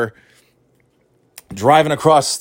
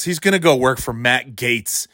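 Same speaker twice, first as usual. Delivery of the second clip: ordinary talk, nothing marked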